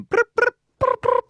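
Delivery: high ringing brr brr; phone ring imitation. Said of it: "That's me just going".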